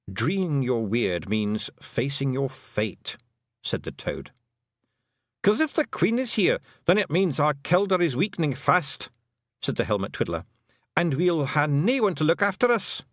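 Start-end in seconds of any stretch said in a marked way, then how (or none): 0.1-3.2 slow, drawn-out deadpan croak; unhurried know-it-all; dry Toad. Acted. The Toad explaining to Tiffany. 5.4-13.0 put-on high, lilting Scots-accented little man; a cheeky tiny clansman owning up; wee Scottish Feegle. Feegle chief confessing to the young witch, high Scots voice.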